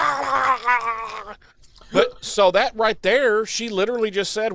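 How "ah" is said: angry yell. Retold or imitated. Angry yell after quote.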